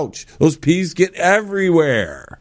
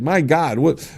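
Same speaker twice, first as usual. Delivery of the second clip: ordinary talk, nothing marked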